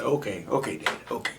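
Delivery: calm him down under his breath; hushed reply. Retold or imitated. Son answers daddy, near-whispered okays.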